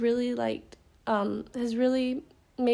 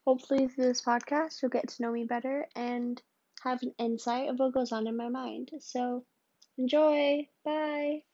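a gentle announcer-style welcome; quiet radio host opening; podcast intro voice; 2 semitones higher, 3 dB quieter than usual. Acted.

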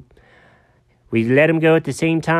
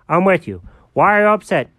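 ordinary delivery

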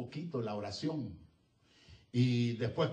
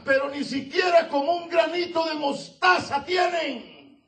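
high, loud, building sermon cry; full-force preaching; 7 dB louder than usual. Real, not acted. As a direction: preach it hard to the pews. (predicación a todo pulmón, aguda y fuerte)

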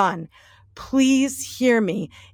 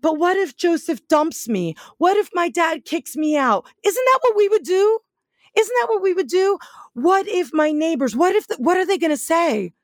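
higher, fretful worrying voice; worried questions, pitched up and rushed; anxious what-ifs; 6 semitones higher, faster than usual. Retold or imitated. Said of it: "pacing her room going".

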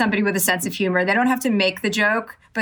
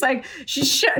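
normal talking voice

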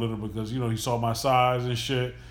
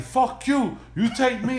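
retold shout at the cab driver, pitched up; mock yell; 9 semitones higher, slower than usual. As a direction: drunk passengers yelling at the taxi driver, mock shout. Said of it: "they're screaming at the taxi guy".

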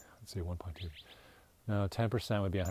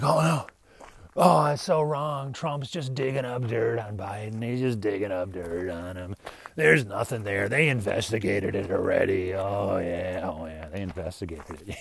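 mock serious people; 3 dB louder than usual. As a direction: mimic the overly serious critics, hammed-up outrage. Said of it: "like, super serious".

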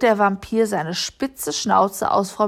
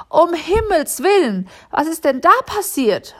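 alarmed; 8 semitones higher than usual. A narrator's reading. Alarmed by shouting in stairwell.

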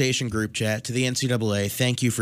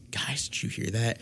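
much softer, asking seatmates; hushed question; 8 dB quieter than usual. Retold or imitated. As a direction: lean over and ask quietly. Whispering to seatmates on plane.

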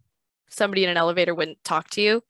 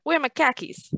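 higher-pitched Boston khakis; Boston accent; 8 semitones higher than usual. Shown as a demonstration. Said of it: "you know, Boston".

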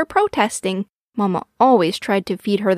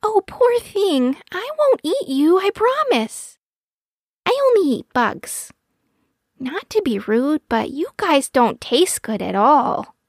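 put-on reassuring baby owl; 7 semitones higher than usual. A narrator's reading. Baby owl promising not to eat mouse, reassuring. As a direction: soothe a nervous friend, pitched high.

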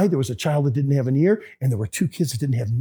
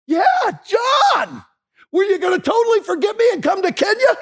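much higher, mock-hurt buddy voice; playful scolding friend. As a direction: scold an old friend for forgetting you, half-joking. John playfully scolding his friend.

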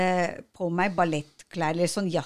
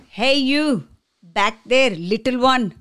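loud, barking call across the room, higher; mock teacher shout; 5 semitones higher, 6 dB louder than usual. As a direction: call out like a pushy yoga guru. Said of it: "han bare". (imiterer en brautende yogalærer som roper)

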